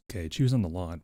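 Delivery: normal talking voice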